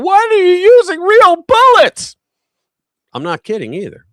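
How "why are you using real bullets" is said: louder, higher, shrill mock yell; mock shout. Host mimics the woman shouting at agents, loud mock yell. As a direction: yell it like the protester. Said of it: "she shouts out".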